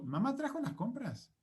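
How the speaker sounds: "¿Mamá trajo las compras?" pitched high as a question, swooping up on each word; rising question intonation. Shown as a demonstration. Teacher turns the statement into a question, rising intonation. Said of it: "solamente con hacer esto".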